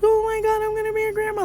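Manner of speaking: very high, loud mock squeal; hammed-up shriek of delight; jumping for joy. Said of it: "they, like, jump for joy".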